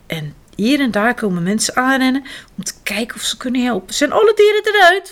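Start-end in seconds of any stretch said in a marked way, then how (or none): 3.9-5.1 high, loud anxious call; urgent question. A narrator's reading. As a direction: call out over the noise. Bystander calls at fire. (bezorgd roepen)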